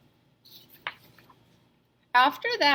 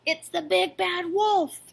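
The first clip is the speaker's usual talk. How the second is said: high, panicked piglet cry; little pig spotting the wolf; frightened cry; 9 semitones higher than usual. A narrator's reading. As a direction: squeal the warning in alarm. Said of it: "cried Horace".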